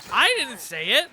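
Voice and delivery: loud denial. Real, not acted. Denying a joke, raised voice.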